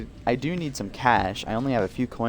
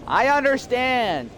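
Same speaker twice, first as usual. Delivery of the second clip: higher and louder, slowly; loud, slow shout; 13 semitones higher, 5 dB louder, slower than usual. Acted. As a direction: shout it back at attention. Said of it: "Speak up. I can't hear you".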